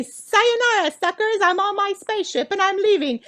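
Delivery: high, cocky goodbye yell; gloating farewell. Pretend. A departing traveller taunts those left behind, high and cocky. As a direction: crow it as you blast off. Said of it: "and you say".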